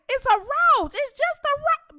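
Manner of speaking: high mock auntie voice; dismissive auntie. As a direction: imitate auntie brushing it off. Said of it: "my auntie was like".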